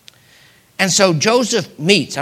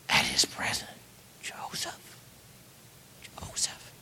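hushed whisper; 17 dB quieter than usual. Acted. The troubled brothers realising who stands before them, whispered. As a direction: whisper the name twice.

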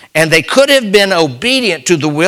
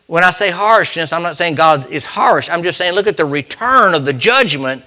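ordinary talk

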